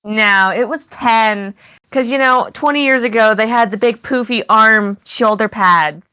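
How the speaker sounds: put-on slow, sing-song valley girl accent on it was ten; hammed-up valley girl accent about old fashions. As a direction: play the valley girl correcting her friend. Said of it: "I can do that accent".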